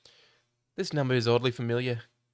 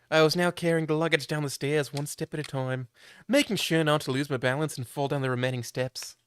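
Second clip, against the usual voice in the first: ordinary talk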